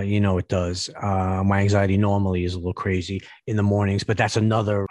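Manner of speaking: ordinary delivery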